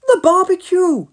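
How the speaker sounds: high, loud panicked shout. A narrator's reading. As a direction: shout as you remember the food. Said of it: "shouted Elvis".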